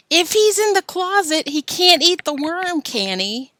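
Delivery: put-on high eager boy voice; a boy pushing his crazy plan; Alan scheming. Alan urging Joe, high and eager.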